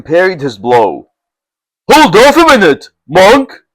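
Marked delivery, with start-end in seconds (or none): 1.9-3.6 high, louder call to stop; crying out. Acted. Chieftain stopping the monk mid-fight, crying out. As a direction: halt the fight at once. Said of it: "he cried".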